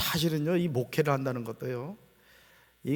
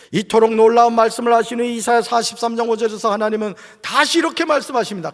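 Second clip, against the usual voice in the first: loud, impassioned sermon voice; ringing pulpit declaration; full-force preaching; 8 semitones higher, 10 dB louder than usual. Real, not acted. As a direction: proclaim it with rising fervour.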